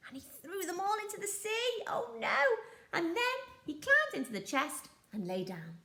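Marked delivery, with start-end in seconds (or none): 1.9-2.4 high, hammed-up oh no; mock dismay. A narrator's reading. Narrator to young listeners, high mock dismay. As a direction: gasp at the lost gold in mock dismay.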